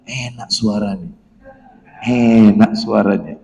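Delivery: lightly voiced low murmur of a tempted man; a hint of a man savouring her voice; inner voice. Man imagining the woman's voice, mild low murmur. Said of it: "dalam hati".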